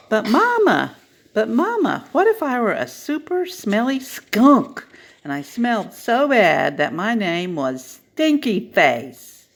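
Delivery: put-on high, pleading little child asking mama; a small kid begging for an answer; excited child's voice tugging at mama. Child voice in story.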